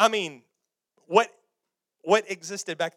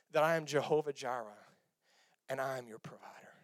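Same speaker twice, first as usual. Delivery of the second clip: hushed God voice; 8 semitones lower, 9 dB quieter than usual. Acted. God whispering his name to Abraham, hushed and low.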